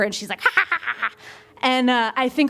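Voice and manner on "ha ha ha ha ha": high imitated monkey noise; higher-pitched mad monkey ha-ha; angry monkey. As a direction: mimic an enraged monkey's panting laugh. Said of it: "She's this big ball of anger".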